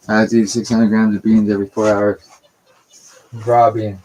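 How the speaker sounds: loud, hammering the claim; loud emphasis. Real, not acted. Streamer telling viewers about his raw beans diet, loudly. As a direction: punch it out loudly.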